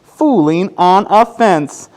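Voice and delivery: slow, lilting storybook counting; reading to children. A narrator's reading. Teacher reading a counting book to kids, gentle.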